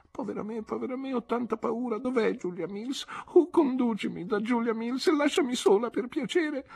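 very high, wailing and scared; Dora frightened. A narrator's reading. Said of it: "non fece che esclamare". (lamento spaventato, voce altissima)

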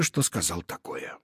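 ordinary delivery, nothing marked

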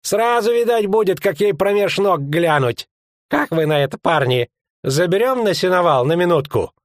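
high-pitched jeering ruffian; leering fat lout. Acted. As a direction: egg the lads on in a high voice.

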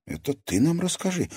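high-pitched concern; anxious prompting; worried. A narrator's reading. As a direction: say it with worry. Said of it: "обеспокоенно произнесла Катерина".

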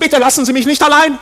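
loud, high, desperate begging; panicked plea. Acted. Frightened visitor begging the guide to stay, panicked. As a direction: cry out not to be left alone. Man begs guide not to leave.

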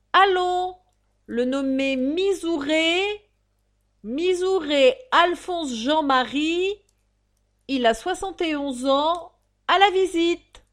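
high, loud, drawn-out crier's call; town-crier bark with stretched vowels; drawling roll-call shout. Acted. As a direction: bellow each name slowly to a crowd. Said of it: "continuait sur le même ton".

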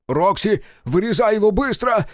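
high-pitched frantic order; urgent yell. Acted. Engineer orders Рокси to cut fast, urgent yell. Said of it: "завопил Сальф".

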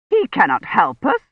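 put-on scornful old lady's dismissal; contemptuous. A narrator's reading. Lady Hermione scorns fetching the priest, contemptuous. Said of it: "Hermione said contemptuously".

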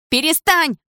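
high, urgent cry; scream. A narrator's reading. Dana to the man hurting her, high scream. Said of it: "закричала девушка".

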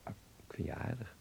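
soft whispered confession; hushed tender whisper. Acted. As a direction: whisper it close to her. Intimate reply, unvoiced.

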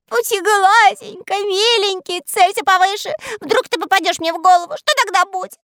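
very high, loud pleading; tearful begging. A narrator's reading. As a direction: beg the archer sweetly. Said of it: "рыдал Мурзилка". (умоляет сквозь слёзы)

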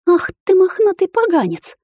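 high-pitched indignant scolding; high, exasperated swearing to oneself; cursing inwardly. A narrator's reading. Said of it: "выругалась про себя".

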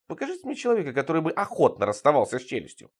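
plain reading voice

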